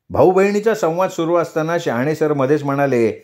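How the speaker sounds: usual speaking voice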